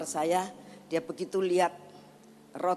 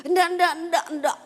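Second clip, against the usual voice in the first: high, loud imitation of a caught toddler; child's hasty denial; 9 semitones higher, 7 dB louder, faster than usual. Grandchild caught biting nails denies it to Oma, mock childish protest. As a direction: deny it over and over in a high child voice.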